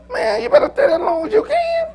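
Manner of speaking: high, loud shout breaking into laughter; hollering advice between laughs; laughing shout. Real, not acted. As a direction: crow it out through laughter. Urging young adults, laughing shout.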